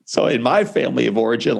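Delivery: usual speaking voice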